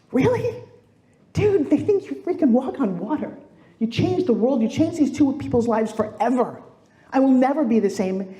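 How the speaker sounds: high, disbelieving "really" into emphatic praise; incredulous reassurance. Retold or imitated. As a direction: act out amazed, emphatic reassurance. Acting out her amazed reply.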